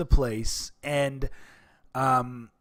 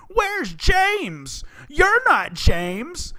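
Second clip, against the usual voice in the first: put-on high quavery elderly voice, much higher; old people voice; 12 semitones higher, 4 dB louder, much slower than usual. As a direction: imitate confused retirees in a high voice.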